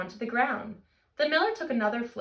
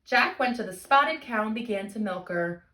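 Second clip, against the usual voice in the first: ordinary talk, nothing marked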